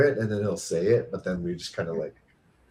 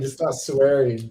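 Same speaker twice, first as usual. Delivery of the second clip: normal talking voice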